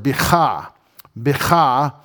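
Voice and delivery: bicha with a rasped back-of-throat ch; throaty Hebrew ch. Shown as a demonstration. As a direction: scrape the ch of bicha in the throat, bi-KHAH. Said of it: "a letter that makes you say that".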